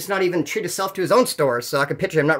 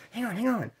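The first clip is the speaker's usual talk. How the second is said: quiet, higher imitation of a distracted caller; hushed stalling; 4 semitones higher, 11 dB quieter than usual. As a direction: put him off under your breath. Caller stalls while on the phone.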